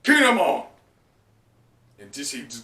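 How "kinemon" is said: loud, high cry; cry of Kinemon's name. Acted. Comrade crying out Kinemon's name, loud cry. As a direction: call his name out in shock. Character cries out after punch.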